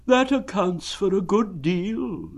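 high, slow gloomy donkey voice. Acted. Eeyore gloomily accepting his lost tail. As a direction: say it like everything proves the worst. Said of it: "said Eeyore gloomily".

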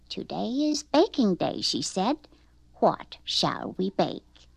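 a hint of a kindly grandma; grandma musing over what to bake; lightly voiced grandma. Grandma announces baking day.

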